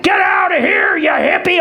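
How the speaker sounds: gruff mock yell. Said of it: "They're like".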